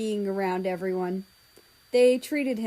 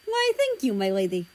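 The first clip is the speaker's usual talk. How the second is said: with a smile. A narrator's reading. Russia teasing Kiwi as he thanks her. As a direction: smile through a mock-courtly thank you. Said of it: "he said with a smile".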